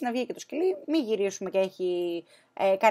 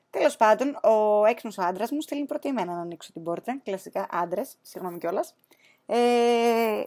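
ordinary delivery

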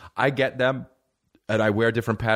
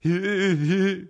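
high, goofy mock giggle; imitated laugh; 9 semitones higher than usual. Comic showing how buddy laughs at everything, mock giggle. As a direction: mimic the friend's silly giggle. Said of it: "He laughs at everything".

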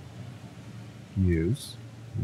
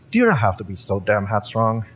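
ordinary talk

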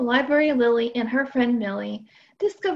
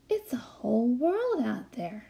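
awed whisper; 6 dB quieter than usual. Acted. Millie showing Lily the view from the tree, whispering. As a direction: whisper it like a secret.

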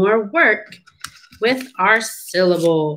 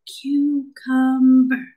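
"Cucumber" very slow and higher, each syllable apart; 4 semitones higher, much slower than usual. Shown as a demonstration. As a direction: stretch "Cucumber" into three separate counted beats. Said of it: "How many?".

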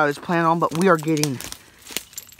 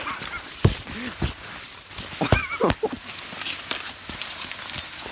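wheezing laugh; 6 dB quieter than usual. Real, not acted. Laughing at own joke.

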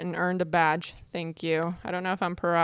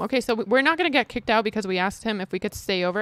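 normal talking voice

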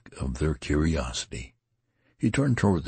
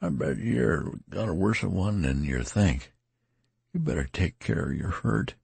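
tattered man; 6 semitones higher than usual. A narrator's reading. Tattered man urges care.

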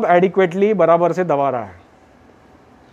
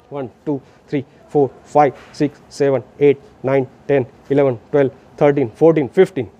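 low, steady rhythmic count; counting aloud; 5 semitones lower than usual. Shown as a demonstration. Rescuer counts to fifteen so partner can prepare breaths, aloud and rhythmic. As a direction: count each compression out in an even beat. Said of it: "मैं ज़ोर से बोल रहा हूँ".

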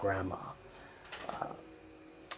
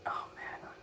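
whisper; 8 dB quieter than usual. Retold or imitated. Muttering to himself over food.

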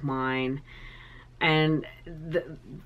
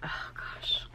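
tearful whisper; 9 dB quieter than usual. Real, not acted. Moved by gift.

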